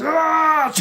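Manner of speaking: high, loud mock scream; imitated falling scream. Taped man falling from the tower, mock scream. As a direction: scream like you are dropping. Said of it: "and he goes".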